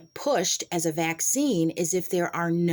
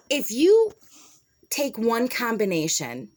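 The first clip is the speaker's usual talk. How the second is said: heated rant; 4 semitones higher, 4 dB louder than usual. Real, not acted. Heated explanation of her point.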